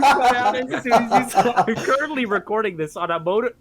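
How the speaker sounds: laughing through the words; cracking up mid-sentence. Real, not acted. Laughing at the glitch.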